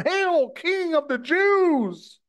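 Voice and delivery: mock reverence, slow and sneering; mocking hail. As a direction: slowly jeer it like a soldier bowing in scorn. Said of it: "they laughing and ridiculing him".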